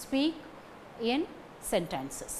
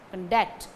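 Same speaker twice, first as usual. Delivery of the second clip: conDUCT, weak con then firm DUCT; stress on second syllable; 4 semitones lower than usual. Shown as a demonstration. Teacher repeats the verb form, conDUCT stressed second. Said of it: "The stress falls on the second".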